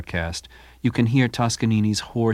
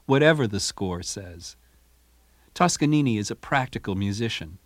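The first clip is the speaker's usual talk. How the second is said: usual speaking voice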